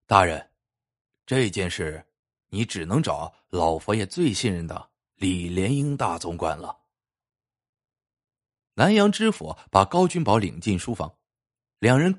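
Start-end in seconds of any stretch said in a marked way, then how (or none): 0.1-6.9 low, deliberate counsel, softening at the end; pensive advice. A narrator's reading. Carver to prefect after thinking it over, pensively. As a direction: advise gravely in a lowered voice. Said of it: "高君宝沉思片刻".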